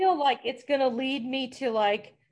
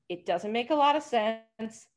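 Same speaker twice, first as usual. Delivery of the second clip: ordinary delivery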